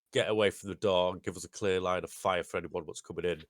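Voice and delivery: ordinary talk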